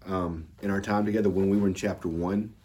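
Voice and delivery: ordinary talk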